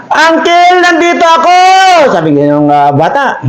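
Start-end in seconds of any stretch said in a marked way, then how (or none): 0.1-1.6 put-on boy answers the uncle; calling back. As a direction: call back from the ditch.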